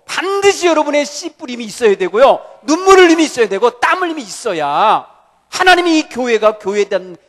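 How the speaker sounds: loud, high, urgent exhortation; full-force pulpit appeal; fervent preaching. Acted. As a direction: call the church to sow in tears.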